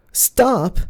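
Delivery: very high, loud scream; desperate scream. Acted. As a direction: scream it to shield him. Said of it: "I screamed".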